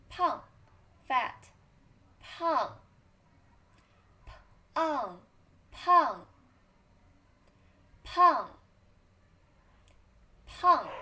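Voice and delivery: 胖 starting high and dropping sharply; loud 胖 falling steeply; fourth tone 胖. Shown as a demonstration. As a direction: snap 胖 down from high. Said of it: "Read after me".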